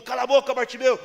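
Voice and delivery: high, very loud order to hush; shouted rebuke. Acted. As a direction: shout him down like an angry crowd. Crowd silencing the shouting blind man.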